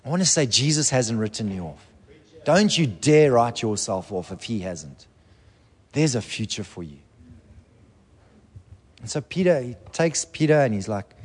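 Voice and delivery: usual speaking voice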